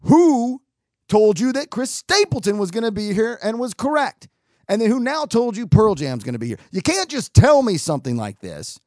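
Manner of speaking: loud, high, indignant grilling; overdramatic demand. Retold or imitated. Festivalgoer grilling the man with the rumor, overdramatic. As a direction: demand answers with hammed-up outrage. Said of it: "over dramatic in this state".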